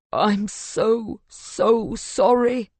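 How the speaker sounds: drawn-out, anguished apology; moaning. A narrator's reading. Husband holding his wife's body, moaning in sorrow. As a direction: moan it slowly, pitch lifting with grief. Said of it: "he moans".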